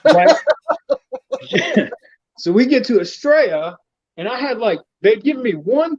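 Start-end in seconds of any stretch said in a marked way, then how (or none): 0.4-1.9 high-pitched cackle at own joke; high burst of laughter. Real, not acted. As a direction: break into a squealing laugh.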